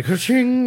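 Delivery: high, playful cash register noise; cash-till ka-ching. Acted. Blacksmith's eyes turn to dollar signs, ka-ching. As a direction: ring it up like a till.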